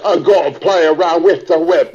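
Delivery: a touch of the put-on Cockney voice; lightly slipping back into the Cockney bit; Cockney accent. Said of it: "the Cockney guys".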